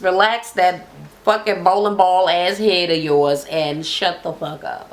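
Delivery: ordinary talk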